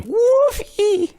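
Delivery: high squeal of delight; squealing Woofy. Pretend. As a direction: squeal the name like an excited kid. Squealing dog's name again.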